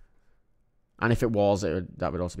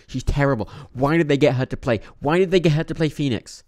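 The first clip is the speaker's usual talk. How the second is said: exasperated rant; 6 semitones higher, 7 dB louder than usual. Real, not acted. Ranting about a casting choice.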